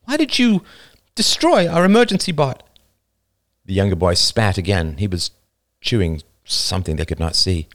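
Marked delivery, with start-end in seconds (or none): 0.0-2.6 high, sharp unfamiliar voice; new harsh tone. A narrator's reading. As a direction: challenge them in a new voice. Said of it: "She had never heard this tone".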